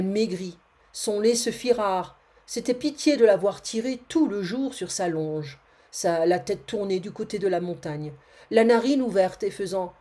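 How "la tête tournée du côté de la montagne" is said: usual speaking voice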